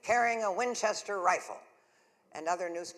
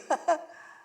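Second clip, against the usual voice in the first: bright laugh; 9 semitones higher, 8 dB louder than usual. Acted. Laugh after shooing reporters.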